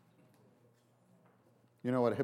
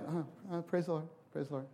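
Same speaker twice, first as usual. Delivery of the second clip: half-hearted praise; 4 semitones higher, 4 dB quieter than usual. Retold or imitated. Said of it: "it's not like".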